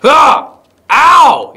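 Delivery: high, startled cries; short loud yelps. Real, not acted. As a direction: yelp as if hit. Hurt during game action.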